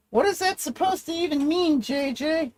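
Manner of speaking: high, puzzled other voice; other person's voice. Acted. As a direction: ask it as someone else. Another voice asks.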